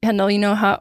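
ordinary talk, nothing marked

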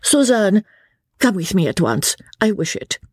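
high-pitched, imperious command; peremptory. A narrator's reading. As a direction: order a daughter away at once. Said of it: "said the Comtesse peremptorily".

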